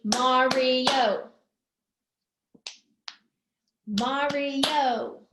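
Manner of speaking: MARIO in three level beats; syllable by syllable. Shown as a demonstration. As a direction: count out MA, RI, O in MARIO. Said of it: "Mario has three".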